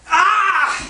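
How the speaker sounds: sharp high cry of agony; a sudden high anguished yell; cry of pain. Acted. Job collapses in pain.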